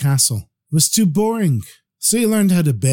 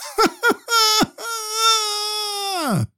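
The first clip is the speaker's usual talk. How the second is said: desperate wail calling to his father; anguished scream; 4 dB quieter than usual. Acted. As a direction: scream from the deepest place in your heart. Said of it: "the young man screamed".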